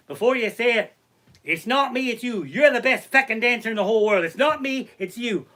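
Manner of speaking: put-on high, loud, excited Irish accent; hammed-up Irish enthusiasm; Irish cousin. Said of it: "two fucking crazy Irish cousins".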